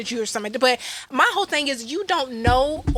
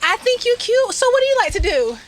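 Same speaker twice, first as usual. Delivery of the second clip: much higher, sing-song flirty opener; mock flirty greeting; 11 semitones higher, 3 dB louder than usual. Host to listeners, acting out flirting with a new guy. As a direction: put on a cute first-date voice. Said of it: "Where I be like".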